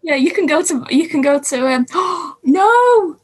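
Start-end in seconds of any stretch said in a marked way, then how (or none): 1.9-2.4 quick startled gasp; sharp gasp of surprise. Real, not acted. Cohost suddenly drops off call. 2.4-3.2 high, loud dismayed no; shocked no. Real, not acted. Cohost suddenly drops off call.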